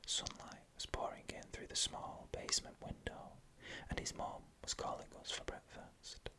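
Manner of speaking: ordinary delivery, nothing marked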